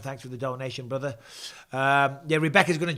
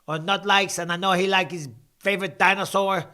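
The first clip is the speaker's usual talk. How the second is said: higher, louder mock Canadian accent; put-on Canadian accent; 3 semitones higher, 5 dB louder than usual. Streamer answering a chat jibe about the accent, mock Canadian accent. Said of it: "not impressed with that accent".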